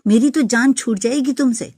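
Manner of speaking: furious high yell at her husband; shouted curse. Retold or imitated. Wife screaming at her husband as he leaves, furious yell. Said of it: "میں نے چلا کر کہا".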